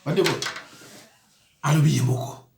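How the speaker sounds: ordinary delivery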